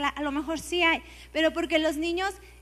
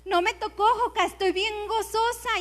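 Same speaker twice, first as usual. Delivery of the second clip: joyful woman quoted; 6 semitones higher, 5 dB louder than usual. Retold or imitated. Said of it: "me dice".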